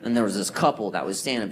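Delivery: ordinary talk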